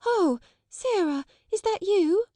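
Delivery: put-on high, flustered girl voice; nervous girl. A narrator's reading. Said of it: "break into a short hysterical laugh and exclaim, aimlessly".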